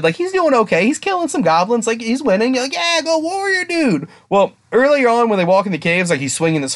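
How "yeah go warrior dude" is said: high, drawn-out rooting cheer; mock cheering. Imitating a viewer rooting for the warrior, mock cheering.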